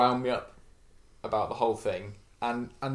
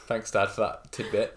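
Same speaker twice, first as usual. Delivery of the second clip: ordinary talk, nothing marked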